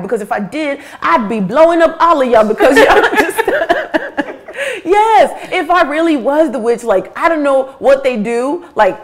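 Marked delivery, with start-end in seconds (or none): 1.0-3.4 louder, higher, laughing through it; laughing at the absurdity; loud, high, real laughter. Real, not acted. Laughing at witch accusation. 3.5-4.9 very high burst of laughter; real high laughter. Real, not acted. Laughing at witch accusation. 5.0-9.0 louder and higher, still laughing; mock the accusers while laughing; amused, higher and louder. Real, not acted.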